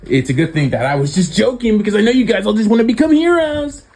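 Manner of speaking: high, teasing teacher voice, rising on the last line; mock the class as the teacher; a playful teacher ribbing his students; sarcastic teacher. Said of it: "and say".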